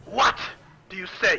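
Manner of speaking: high, loud startled dwarf cry; startled outburst. Acted. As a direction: shout it in alarm, high.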